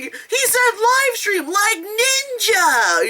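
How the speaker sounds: slow high squeal; gleeful shriek; excited kids. Retold or imitated. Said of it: "They're like".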